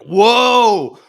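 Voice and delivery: mocking people who flinch when snapped back at, hammed-up alarm; mock alarmed whoa. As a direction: ham up someone recoiling in shock.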